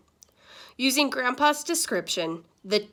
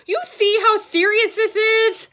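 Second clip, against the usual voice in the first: much higher, louder toothless wail; moaning; 13 semitones higher, 10 dB louder than usual. Acted. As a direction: moan it like a tragedy. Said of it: "moaned Grandpa".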